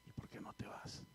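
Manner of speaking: barely audible whisper; suavecito. Retold or imitated. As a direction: whisper the question very gently. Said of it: "Pero así". (en un susurro muy suave)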